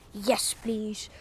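hushed yes please; whispering. Real, not acted. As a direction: whisper it eagerly. Evolving, hushed.

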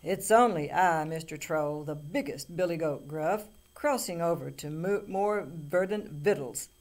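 booming, very loud big-goat voice; biggest goat. A narrator's reading. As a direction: boom it out like the biggest billy goat. Biggest goat answers the bellowing troll.

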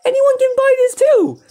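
high, amazed announcement; excited exclamation. Real, not acted. Gamer tells viewers of the bargain, high excited cry. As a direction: proclaim it with big excitement.

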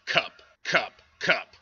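loud repeated naming of cup; teaching a toddler, loudly; calling out words. Retold or imitated. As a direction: call each word out to a child. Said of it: "I'm like".

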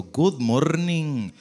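slow English greeting with a heavy Spanish accent. Shown as a demonstration. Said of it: "lo hablamos todo con acento".

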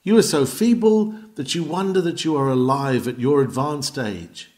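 ordinary talk

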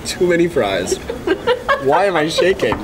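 ordinary talk, nothing marked

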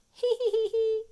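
very high, loud giggling; high giggle. A narrator's reading. The family laughs together at George's growl. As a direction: giggle like a delighted child. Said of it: "Everybody laughs".

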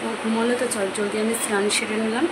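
soft, subdued voice beside a sleeper; speaking softly. Real, not acted. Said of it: "আস্তে আস্তে কথা বলছি যাতে ও ডিস্টার্ব না হয়".

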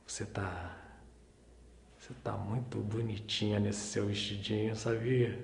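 low, very soft compliment; tender sweet-talk. Acted. Man admiring Luísa's little dress, tender sweet-talk. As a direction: murmur it fondly, low and intimate.

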